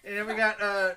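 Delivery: loud, high hype-man introduction; rodeo announcer voice. Pretend. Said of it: "That voice".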